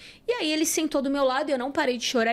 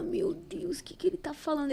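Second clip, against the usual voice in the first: soft unvoiced whisper of disbelief; stunned whisper; 5 semitones higher, 6 dB quieter than usual. Her inner reaction to Jesus, soft stunned whisper. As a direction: whisper it to yourself in shock.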